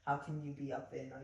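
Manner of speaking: ordinary talk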